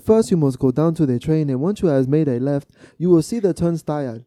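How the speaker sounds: giving directions in an imitated African accent; mock African accent. Answering a lady asking for train directions, put-on African accent. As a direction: copy an African accent while explaining the route. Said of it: "and she was African".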